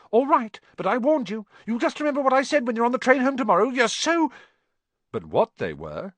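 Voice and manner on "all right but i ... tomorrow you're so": high, loud, speeding-up tirade; indignant warning. A narrator's reading. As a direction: warn them in a fast, rising huff. Girl gives angry last warning.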